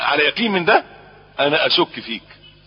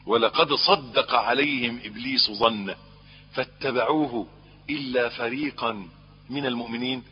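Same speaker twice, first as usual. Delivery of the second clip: slow, chanted Quranic recitation; 3 semitones lower, 5 dB quieter, slower than usual. Acted. Preacher reciting the verse to the congregation, slow chant. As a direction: intone the verse slowly.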